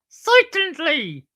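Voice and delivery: very high, loud, slow stooge squeal; Curly from the Stooges. Shown as a demonstration. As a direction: exaggerate the catchphrase way up high. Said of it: "sound like Curly and Moe from".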